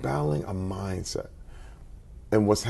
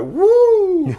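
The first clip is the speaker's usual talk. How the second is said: much higher, much louder cheer; son's joyful woo; 11 dB louder than usual. Retold or imitated. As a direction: burst out like a kid freed to go swimming. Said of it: "he was like".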